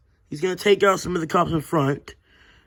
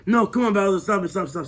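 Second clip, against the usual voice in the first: ordinary talk, nothing marked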